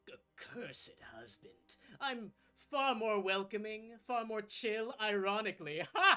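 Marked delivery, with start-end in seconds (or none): 2.0-5.8 boastful. Acted. Archfey boasting to guests. 6.0-6.2 a single loud, high burst of laughter "Ha!"; sharp laugh. Acted. Laughs at own joke.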